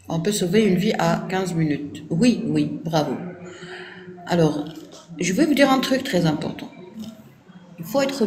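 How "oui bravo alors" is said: usual speaking voice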